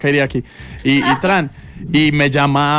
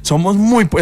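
mock lament; 6 semitones higher, slower than usual. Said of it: "Decíamos".

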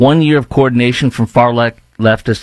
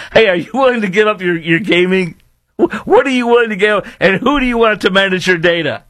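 higher-pitched, re-enacted challenge to a son; animated self-quote; 7 semitones higher than usual. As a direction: replay pressing your son with questions. Retells questioning his son.

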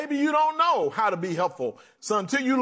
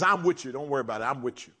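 reassuring God; 7 semitones lower, much faster than usual. Acted.

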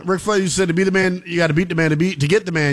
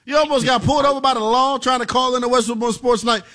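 higher, incredulous question; can't believe what he's hearing; amused disbelief; 6 semitones higher than usual. Real, not acted. Host reacting to a caller nearly pulled over, amazed.

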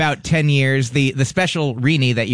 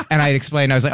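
normal talking voice

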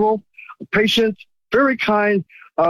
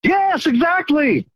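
excited agreement; 8 semitones higher, slower than usual. Real, not acted. Caller eagerly agrees about free money.

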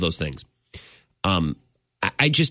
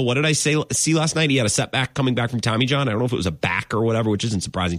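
ordinary talk, nothing marked

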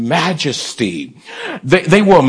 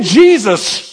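emphatic raised-voice call; shouted cue; 4 semitones higher, 4 dB louder than usual. Shown as a demonstration. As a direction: call it out for the congregation to shout back. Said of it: "Can you shout".

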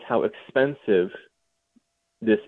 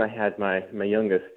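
ordinary talk, nothing marked

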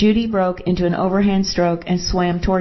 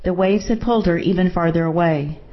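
plain reading voice

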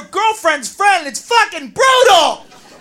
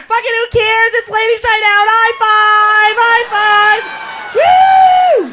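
put-on giddy party girls; 5 semitones higher than usual. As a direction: celebrate ladies night at full volume.

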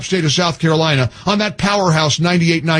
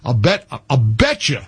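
low, relaxed host ad read, a touch lower than his rant; radio ad read; 6 semitones lower than usual. Acted. Host pitching a mouthpiece sponsor to his radio audience, easy ad read. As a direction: sell the sleep device casually to listeners.

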